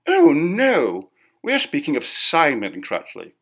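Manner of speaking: sudden high, loud cry of surprise; startled protest, voice jumping up; shocked woman crying out; surprised exclamation. A narrator's reading. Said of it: "Missus Storgate exclaimed".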